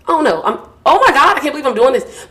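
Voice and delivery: overcome, laugh it off while choking up; real emotional break with a laugh. Real, not acted. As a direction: voice jumps up high and loud, laughing through tears. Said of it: "I'm getting a little emotional".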